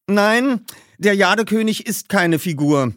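Justus, eager. A narrator's reading. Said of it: "mischte sich Justus ein".

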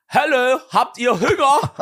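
high, slow East German duck call; man calling ducks in a high, slow East German accent. Retold or imitated. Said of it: "ruft er die Enten so".

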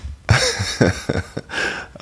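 silent, airy giggle; breathy embarrassed laugh. Real, not acted. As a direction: laugh under your breath, caught off guard. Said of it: "laugh at awkward question".

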